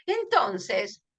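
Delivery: ordinary talk, nothing marked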